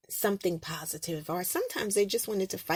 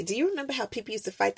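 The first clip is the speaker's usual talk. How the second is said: normal talking voice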